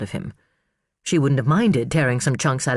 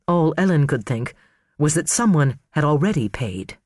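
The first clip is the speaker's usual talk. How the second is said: ordinary talk, nothing marked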